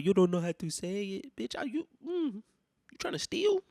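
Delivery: high, mock-outraged challenge; comic indignation, pitch soaring on the accusation; incredulous accusation. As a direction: mimic their excuse back, climbing into a squeal. Acts out accusing the clerk.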